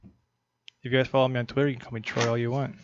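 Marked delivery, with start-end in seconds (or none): none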